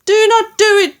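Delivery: loud, very high cry of terror; high panicked shriek; screech. A narrator's reading. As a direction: screech the plea, pitch shooting up. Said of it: "he screeched".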